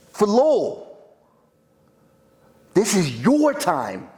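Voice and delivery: rally the fighter in his corner, pushing hard; Dickie pumping up his brother. Acted. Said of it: "I would do that as Dickie".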